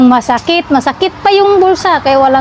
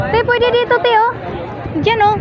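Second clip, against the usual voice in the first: excited calling; 10 semitones higher, faster than usual. Real, not acted. Calling friend to a spot.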